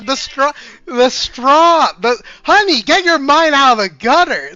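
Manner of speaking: high, loud flustered laugh; laughing protest. Real, not acted. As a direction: insist through laughter.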